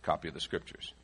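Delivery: ordinary talk, nothing marked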